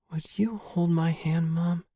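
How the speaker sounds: eerie, airy high request; listless whisper. A narrator's reading. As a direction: whisper a slow, childlike plea. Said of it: "says the listless voice".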